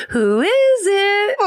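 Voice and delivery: high, slow sing-song call; goofy sing-song. Retold or imitated. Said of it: "I called out in a goofy sing-song voice".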